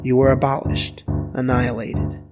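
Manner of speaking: ordinary delivery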